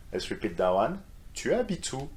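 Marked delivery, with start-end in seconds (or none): 1.3-2.1 "Tu habites où" in a high questioning tune; intonation up. Shown as a demonstration. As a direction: pitch "Tu habites où" well up for learners to repeat. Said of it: "it's better with intonation up".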